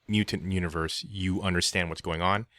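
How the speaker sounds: usual speaking voice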